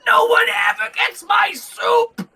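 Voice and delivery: high, roaring innkeeper's cheer; overjoyed big-man roar; elated shout. Acted. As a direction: bellow it joyfully at a very high pitch. Innkeeper's elated bear-hug outburst.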